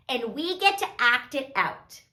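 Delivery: ordinary delivery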